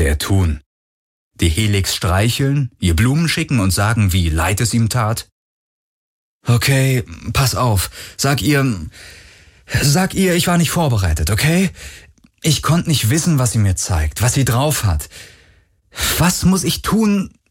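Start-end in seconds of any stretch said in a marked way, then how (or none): 6.5-17.4 put-on higher, anxious young man voice; Galen, desperate to make amends; pleading young hero. A narrator's reading. (flehender junger Held, höher)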